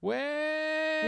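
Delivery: high sing-song host welcome. Acted.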